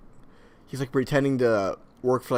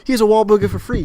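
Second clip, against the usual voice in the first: high, loud, quick celebrity impression; Mark Wahlberg; 9 semitones higher, 10 dB louder, faster than usual. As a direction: push it on them, loud and fast. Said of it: "that's enough of my Mark Wahlberg".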